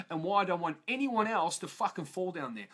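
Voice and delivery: usual speaking voice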